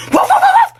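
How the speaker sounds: high, loud yapping barks with a laugh; mock dog barking. Baby mocking his mother by barking like a dog, high and loud. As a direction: bark like a little dog to mock her. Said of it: "Gusto mo tumahol ako?".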